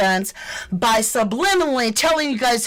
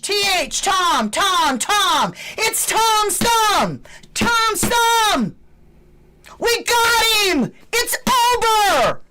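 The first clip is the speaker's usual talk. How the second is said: high, loud triumphant shouts; excited yelling; 10 semitones higher, 4 dB louder, slower than usual. Real, not acted. Vlogger to viewers naming the thumb, triumphant yelling. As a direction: yell the name in victory.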